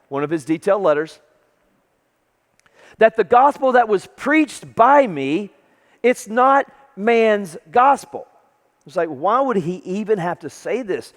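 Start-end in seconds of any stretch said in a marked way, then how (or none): 3.0-8.3 slow, higher, weighty proclamation; declamatory scripture reading. Retold or imitated. Paul defending his gospel to Galatians, emphatic proclamation. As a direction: spell it out slowly and emphatically. Reads Paul's letter emphatically.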